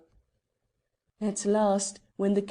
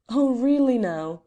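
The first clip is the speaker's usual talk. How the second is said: scoffing disbelief; 4 semitones higher, 8 dB louder than usual. A narrator's reading. Porter scoffs at shawled woman.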